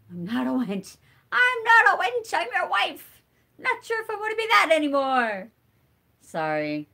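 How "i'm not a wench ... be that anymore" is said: much higher, louder indignant quote voice; Princess Bride quote. Retold or imitated. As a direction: squeal it out like the outraged wife in the movie. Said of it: "one of my favorite movies, Princess Bride".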